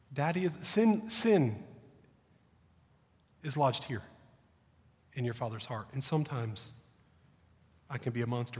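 put-on soft, low confession to a frightened child; father quietly apologizing to his scared son, tender; tender apology. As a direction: gently ask a small son's forgiveness.

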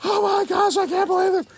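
high, loud gasp of disbelief; a scandalized kid; mock disbelief. Students gasping in disbelief.

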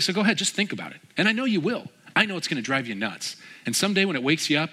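high-pitched, lively imitation of friendly needling; playful goading. Man leaves Jim to think it over, playfully goading. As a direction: needle a friend, showily letting it go. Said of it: "very demonstrative person and super entertaining".

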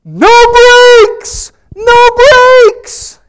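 high, loud panicked shout; frantic yell. Retold or imitated. Father yelling to family in the car, frantic shout. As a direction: scream it like the brakes just failed. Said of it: "frantically yells out".

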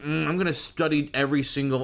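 mock perfectionist. Mocking an over-studious accent perfectionist.

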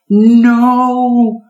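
high piercing scream; little girl screaming at the raccoon, high and loud. A narrator's reading. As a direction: scream it in terror. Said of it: "Amanda screamed".

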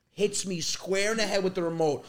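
normal talking voice

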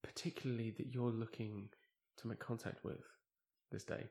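soft, mysterious medium's question, dropping to a near whisper; hushed medium. Retold or imitated. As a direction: lean in like a mystic medium summoning spirits.

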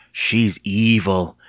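drawn-out, savoring delivery; amused relish. A narrator's reading. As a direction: stretch EVIL out slowly with playful menace. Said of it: "she chuckled as if she'd said something funny".

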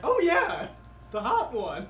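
high, loud, gushing squeal; excited squeal. Real, not acted. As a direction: gush over him excitedly. Excited gushing about hot NPC.

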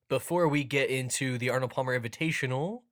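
ordinary delivery

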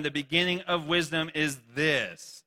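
slow, measured first reading. Shown as a demonstration. Reading the verse slowly to the congregation. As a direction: read it out slowly, weighing each word. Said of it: "Oh, I don't know why it's up there like that".

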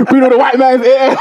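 laughing. Real, not acted. Cracking up at stereotype list.

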